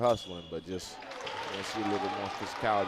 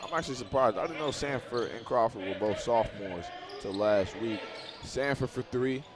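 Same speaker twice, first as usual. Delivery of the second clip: brisk basketball commentary; live play-by-play. Acted. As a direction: call the action as it happens.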